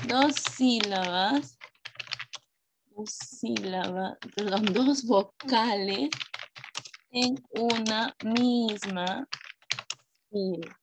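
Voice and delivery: word by word, drawn out with long pauses; slow dictation. Real, not acted. Teacher writing the diptongo definition for pupils, slow dictation. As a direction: say it as you write it, slowly.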